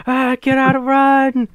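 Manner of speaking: high, loud mock panic; drawn-out cry of a panicking crowd; freaking out. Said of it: "everybody just, like, freaking out".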